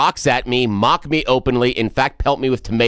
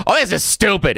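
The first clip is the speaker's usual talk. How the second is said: retold outburst; 10 semitones higher than usual. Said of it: "I go".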